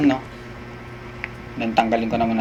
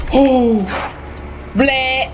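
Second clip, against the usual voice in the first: startled yelps; 10 semitones higher, much slower than usual. Real, not acted. Startled after jump scare.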